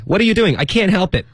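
high, urgent back-and-forth; high-pitched mock argument; acted exchange. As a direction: perform the sitcom quarrel high and quick. Acts out both characters' exchange.

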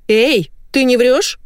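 high, slow, suspicious wife. A narrator's reading. Wife checking if her husband is lying, high and suspicious. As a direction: question him slowly in a high voice.

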